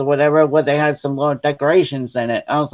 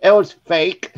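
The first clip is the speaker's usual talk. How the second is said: higher, breaking into laughter; laughing reveal; 7 semitones higher, slower than usual. Retold or imitated. Confessing the spider prank to sister, laughing. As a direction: let the punchline tip into laughing.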